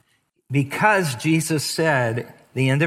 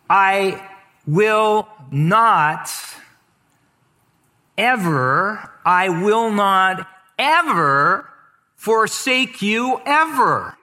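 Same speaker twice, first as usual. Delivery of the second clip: slow, stressed NOT and EVER, higher and louder; emphatic literal reading; 5 semitones higher, 3 dB louder, much slower than usual. Shown as a demonstration. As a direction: lean on "not" and "ever" with pauses between. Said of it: "But actually, it says".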